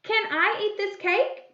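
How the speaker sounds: high question rising on "cake"; question tune. Shown as a demonstration. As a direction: read it as a question, lifting "cake". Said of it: "A question".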